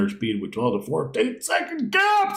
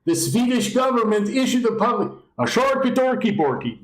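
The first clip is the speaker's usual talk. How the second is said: much higher, hammed-up Swedish Chef babble; cartoonish Swedish accent, higher; mock Swedish accent; 10 semitones higher than usual. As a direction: go full silly Swedish accent into gibberish. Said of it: "I don't know if that's a Swedish accent".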